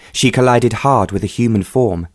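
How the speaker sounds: ordinary delivery, nothing marked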